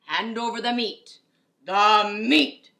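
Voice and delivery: loud demanding crew roar; greedy pirate shout. Acted. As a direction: bellow for food at the table. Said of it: "we all roared".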